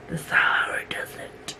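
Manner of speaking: whispered aside. Acted. Describing her dry skin to viewers, whispered. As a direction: breathe it like a secret.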